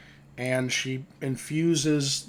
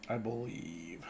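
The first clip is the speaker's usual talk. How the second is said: ordinary talk, nothing marked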